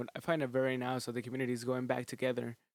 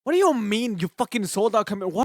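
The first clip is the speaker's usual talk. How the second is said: loud, high disbelieving shout; furious at the news; angry outburst; 7 semitones higher, 8 dB louder than usual. Real, not acted. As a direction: yell it in disbelief. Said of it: "I'm getting mad".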